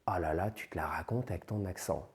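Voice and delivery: whispered mockery of the accent; mocking whisper. Classmates mocking a learner's accent in a whisper. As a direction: sneer under your breath. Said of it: "qu'on vous a dit à tort".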